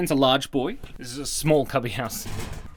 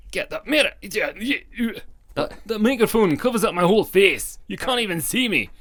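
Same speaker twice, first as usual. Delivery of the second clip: higher, louder bad Scottish accent, cross; bad angry Scottish; 8 semitones higher, 4 dB louder than usual. The inner self on the whiteboard complains in a bad Scottish accent. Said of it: "too angry and a bit too bad Scottish accent".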